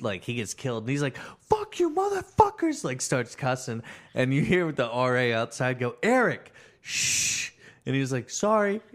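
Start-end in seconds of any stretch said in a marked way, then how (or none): 1.5-2.8 very high, loud gamer rage; imitated angry yell. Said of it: "would get angry and yell". 6.0-7.5 high, loud call, then a sharp shush; imitated scolding call. Acted RA scolding from outside. 8.3-8.8 high, loud little apology; imitated sheepish apology. Gamer's acted apology after scolding.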